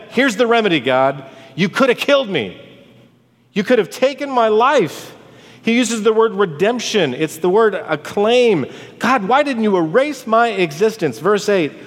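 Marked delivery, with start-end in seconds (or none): 0.1-5.1 high, protesting cry to God; impassioned lament. Acted. Preacher voicing Job's remedy to God, high anguished lament. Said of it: "This is a lament". 9.0-11.2 higher, dramatized lamenting question; anguished plea. Acted. Preacher voicing Job's complaint to God, anguished plea.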